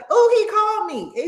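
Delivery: very high mock voice through laughter; laughing imitation. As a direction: squeal the quote, cracking up. Acts out quote while laughing.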